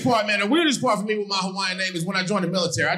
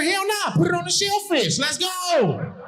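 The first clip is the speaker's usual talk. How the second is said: hell-no shout; 11 semitones higher, slower than usual. Retold or imitated. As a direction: shout it like the idea is ridiculous. Same shouting reply.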